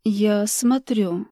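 slow slurred mumble, drugged; words slurring in a drugged haze; slurred speech. Acted. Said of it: "заплетающимся языком пробормотала".

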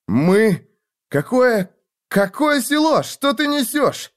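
can't believe what he hears; bewildered shout. Acted. Wounded man confused, shouting.

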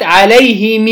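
عليهم said "alayhimii", ending on long ee; kasra with sila. Shown as a demonstration. Teacher restating the result for students, عليهم as "alayhimii". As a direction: hold the final i of عليهم. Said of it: "مع صلة الميم".

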